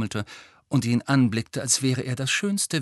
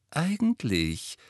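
slow, high, honeyed word; syrupy, pitched-up opener; sugary sweet; 7 semitones higher, slower than usual. A narrator's reading. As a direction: draw it out with mock sweetness. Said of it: "sagte Hermine süßlich".

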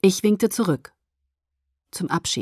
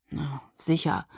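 low, soft sarcastic mumble; sarcastic grumble; 4 semitones lower, 7 dB quieter, slower than usual. A narrator's reading. Jacob answers Bella's demand for her gift, sarcastically grumbling. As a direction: grumble it under your breath, unconvinced. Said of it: "brummte er sarkastisch".

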